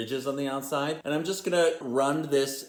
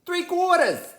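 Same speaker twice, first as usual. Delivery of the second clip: three quarters leapt way up high, then falls; high sing-song repeat; 6 dB louder than usual. Shown as a demonstration. Host repeating the measure for viewers, high sing-song. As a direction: sing the amount back up high, like a jingle. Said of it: "three quarters of an ounce".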